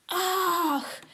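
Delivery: 깨달음의 감탄; high gasp of realization; sudden realization. A narrator's reading. Kitten realizes.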